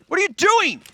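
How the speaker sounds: very high, loud squawk; mock outrage. Caster scolds co-casters for horseplay, high mock-outraged yell. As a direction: shriek it in playful protest.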